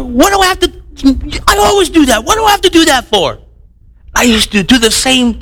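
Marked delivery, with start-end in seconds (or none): 0.0-3.4 put-on much higher whiny teen protest; teen son talking back. Son talking back to his mother, higher whiny voice. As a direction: whine back like a fifteen-year-old. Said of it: "he stands up to her".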